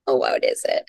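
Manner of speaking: ordinary delivery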